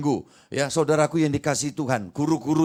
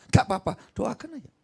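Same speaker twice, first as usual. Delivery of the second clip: normal talking voice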